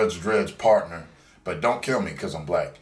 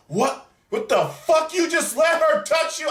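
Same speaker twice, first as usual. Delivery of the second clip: much higher, louder mock shrieking girlfriend; imitating angry girlfriend; 6 dB louder than usual. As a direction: play the jealous girlfriend losing it, high and loud. Girlfriend furious, retold by teller.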